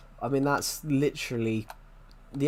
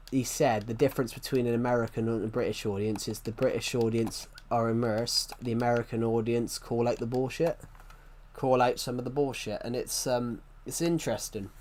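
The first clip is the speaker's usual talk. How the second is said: ordinary delivery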